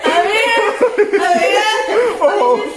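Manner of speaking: slow, high words through laughter; laughing. Real, not acted. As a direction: say it while cracking up. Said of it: "[name] tuvo un huevo". (riendo a carcajadas)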